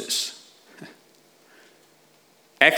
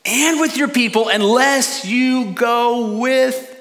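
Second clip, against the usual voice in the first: pleading Moses; 9 semitones higher, 4 dB louder than usual. Acted. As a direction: beg God not to leave, rising urgency. Moses pleading with God, read.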